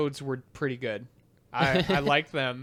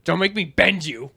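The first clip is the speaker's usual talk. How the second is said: Bender voice; 4 semitones higher, 6 dB louder than usual. Retold or imitated. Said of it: "more of a Bender reference".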